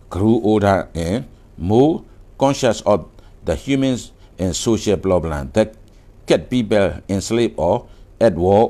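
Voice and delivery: very slow, deliberate textbook reading; slow reading aloud. Acted. Teacher reading the passage to students, very slowly. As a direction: read each English phrase slowly for learners.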